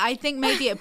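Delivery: usual speaking voice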